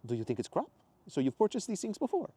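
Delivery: put-on high, curious foreign accent; Mateo's accent. Voices Mateo.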